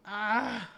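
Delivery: loud exasperated shout; frustrated yell. Real, not acted. Streamer yelling at bad artifact drops, loud frustrated shout. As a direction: let out a sharp angry yell.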